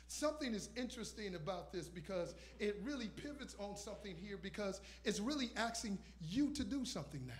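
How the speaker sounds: usual speaking voice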